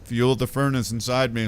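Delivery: ordinary delivery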